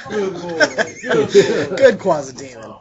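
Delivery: slow, drawn-out praise to a pet; mock praise. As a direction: coo it slowly like praising a pet.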